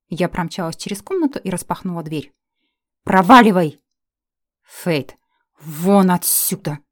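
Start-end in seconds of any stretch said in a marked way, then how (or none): 3.0-6.8 loud, slow furious order; furious demand. Acted. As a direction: order him out in fury. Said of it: "потребовала я".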